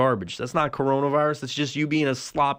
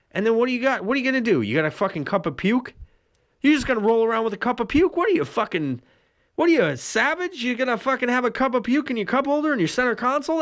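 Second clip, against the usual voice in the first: incredulous rant; 9 semitones higher than usual. Real, not acted. Incredulous rant about puke cup.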